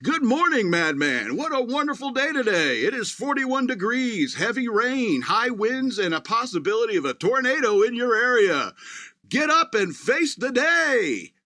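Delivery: sing-song upbeat assistant greeting you; relentlessly perky machine voice; cheerful robot voice. Retold or imitated. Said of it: "stuck on cheerful mode".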